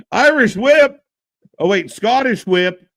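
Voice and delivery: high, loud move call in a put-on Scottish accent; playful Scottish-accent announcer shout; mock Scottish accent. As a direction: call it out as a hammed-up Scot. This is my new thing.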